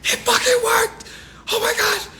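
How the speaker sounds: high triumphant yell of disbelief; excited shout. Retold or imitated. Courtroom winner celebrating his fake scripture, high excited shout. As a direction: shout like you just won the case. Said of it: "They gonna be like".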